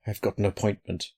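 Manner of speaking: quiet, half-swallowed words; muttered. A narrator's reading. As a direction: mutter it under your breath. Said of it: "muttered Loveday".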